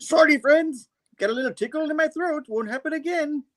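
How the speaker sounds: very high, squeaky apologetic chicken; chicken character voice. Retold or imitated. Chicken Man apologizing for a tickle in his throat, falsetto. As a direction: put on a falsetto cartoon bird voice.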